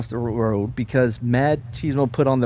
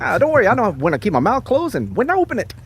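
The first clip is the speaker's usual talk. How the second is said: put-on old-timey voice; 8 semitones higher, faster than usual. Mocking the operative's reply.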